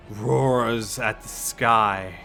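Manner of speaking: ordinary talk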